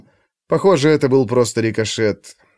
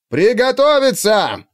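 shouted command; 8 semitones higher, slower than usual. A narrator's reading. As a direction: yell the order to the ranks. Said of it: "Во всё горло завопил".

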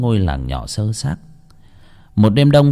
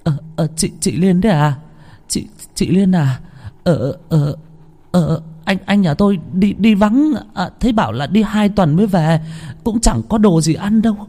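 put-on high, halting, terrified old woman; frightened stammer; 9 semitones higher than usual. A narrator's reading. Grandma answering the ghost at the fence, frightened and stammering. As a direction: stammer out excuses in fear.

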